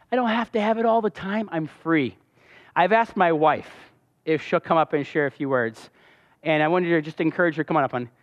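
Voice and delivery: usual speaking voice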